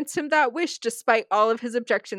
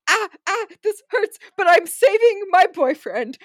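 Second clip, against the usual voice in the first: mock pained Crowley; 9 semitones higher, 7 dB louder than usual. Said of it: "he's just like".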